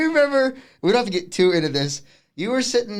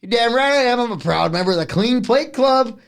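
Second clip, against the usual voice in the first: high, louder, beaming with pride; proud grin; 8 semitones higher, 4 dB louder than usual. Retold or imitated. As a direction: deliver it grinning, proud of himself. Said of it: "smiles real big at her and goes".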